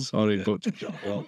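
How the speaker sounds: slow apology through laughter; amused mock-apologetic delivery; laughing apology. Real, not acted. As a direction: say sorry while chuckling. Joking apology amid laughter.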